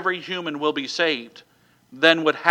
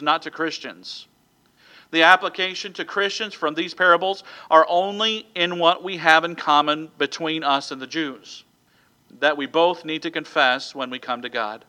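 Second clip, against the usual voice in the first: ordinary delivery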